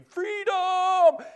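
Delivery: a sudden high, loud "Freedom!" as a hammed-up battle cry; mock freedom cry. Pinned wrestler imagining escape shouts a hammed-up freedom cry. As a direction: yell it as if finally breaking loose.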